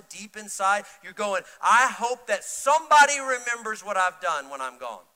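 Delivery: loud, high, impassioned preaching; full-force preaching. Real, not acted. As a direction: press the congregation with rising urgency.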